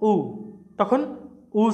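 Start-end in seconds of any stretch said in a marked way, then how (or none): 0.1-0.1 উ said as a rounded oo; double o as oo. Shown as a demonstration. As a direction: round the lips for উ. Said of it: "তখন হয়ে যায়". (ডাবল ও-তে উ ধ্বনি)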